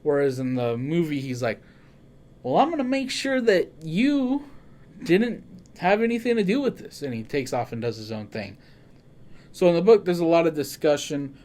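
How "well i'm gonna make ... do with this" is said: suspicious high voice. Retold or imitated. Movie Arbogast warns Sam.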